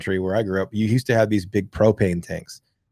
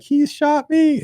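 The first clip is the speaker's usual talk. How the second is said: high, slow whine of a hurt little brother; little brother wailing to mom, high and slow whine; whiny kid brother; slower than usual. Retold or imitated. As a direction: drag it out like a tattling kid. Brother voiced in story.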